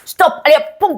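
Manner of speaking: brisk high call like a gust; a lively gust of wind. Acted. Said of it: "le vent allait tourner une page en disant".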